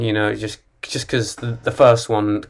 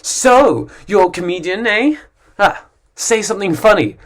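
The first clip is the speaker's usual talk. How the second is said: pushy stranger; 6 semitones higher than usual. Retold or imitated. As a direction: goad the comedian with a sneer. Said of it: "there's always someone who says".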